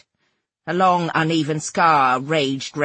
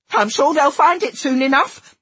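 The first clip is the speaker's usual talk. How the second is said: high, louder, flustered retort; sputtering; 9 semitones higher, 5 dB louder than usual. A narrator's reading. Narrator feeling the situation slip away, sputtering. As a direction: sputter it out as control slips away. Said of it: "I sputtered".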